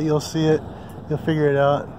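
ordinary talk

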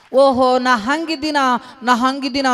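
high, loud delighted cry; joyful realisation. Acted. Kabir suddenly grasps the sadhu's lesson, delighted cry. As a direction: burst out with joy. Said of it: "ಕುಣ್ಕೋತ ಕುಣ್ಕೋತ".

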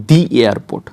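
দি airport, দি a long "dee". Shown as a demonstration. Teacher answering how it is pronounced, দি as "dee". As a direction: stretch দি into "dee" before airport. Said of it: "So এটা কি হবে?".

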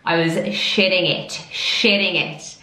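ordinary talk

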